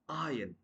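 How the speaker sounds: iron as "eye-un", silent R; correct pronunciation. Shown as a demonstration. Teacher giving the right form, iron as "eye-un". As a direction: drop the R in iron. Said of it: "that's going to be".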